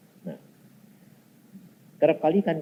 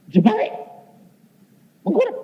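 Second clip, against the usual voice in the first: incredulous; 6 dB louder, faster than usual. Retold or imitated. Incredulous repetition of command.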